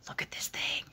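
whispering. Real, not acted. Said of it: "I'm sorry I'm whispering".

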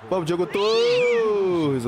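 drawn-out loud cheer; excited cheer. Real, not acted. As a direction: urge the striker on, stretching the name. Cheering on a striker's attack.

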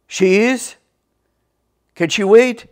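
loud imitation of a big man; manly big voice. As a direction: boom it out like an important man. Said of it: "a very manly, big voice saying".

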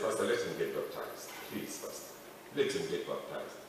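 soft, begging plea to the pastor; hushed please, pastor; quiet pleading. Retold or imitated. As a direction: narrator begs the pastor softly.

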